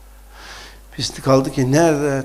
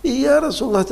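hesitant, higher-pitched reluctant admission; bashful confession in a raised voice; sheepish; 8 semitones higher than usual. Acted. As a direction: play embarrassed, drawing out the address. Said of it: "utanarak, sıkılarak".

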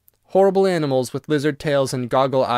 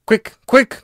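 high, loud urging; frantic, raised ghost voice; urgent cry; 9 semitones higher, 8 dB louder than usual. A narrator's reading. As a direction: cry out in haste. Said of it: "cried the ghost".

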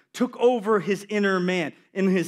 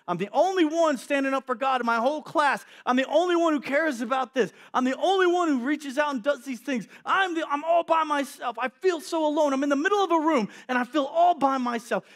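higher, whiny lament of being alone; self-pitying complaint; 8 semitones higher, 4 dB louder than usual. Acted. Lonely prophet complaining to himself, higher and whiny. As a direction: moan it like nobody cares.

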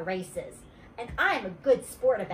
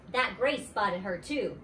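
ordinary delivery

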